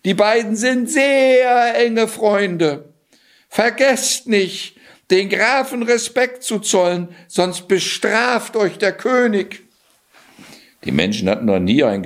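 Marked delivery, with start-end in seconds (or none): 0.0-9.6 high, slow proclamation; calling out. A narrator's reading. Cat warning villagers to honour the count, calling out. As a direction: stretch it out like a town crier. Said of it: "rief er ihnen zu".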